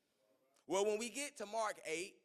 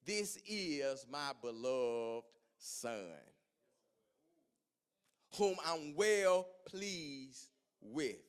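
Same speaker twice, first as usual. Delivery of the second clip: very slow, lower booming voice of God; voice from heaven; 3 semitones lower, 4 dB louder, much slower than usual. Retold or imitated. The voice from the cloud to the disciples: slow solemn intoning. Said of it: "voice said".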